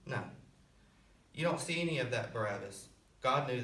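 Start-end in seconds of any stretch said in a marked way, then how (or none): none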